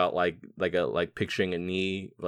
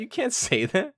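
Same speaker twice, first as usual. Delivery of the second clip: high, disbelieving outburst; incredulous; 13 semitones higher than usual. Real, not acted. Friends mocking a doctor's line, high and incredulous. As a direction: squeak out the disbelief.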